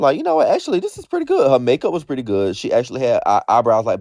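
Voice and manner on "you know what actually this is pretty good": high-pitched retold realization; pleasantly surprised. Retelling a rewatch of the look, pleasantly surprised. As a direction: pitch it right up, won over on second look.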